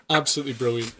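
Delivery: ordinary delivery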